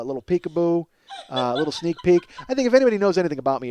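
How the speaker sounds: normal talking voice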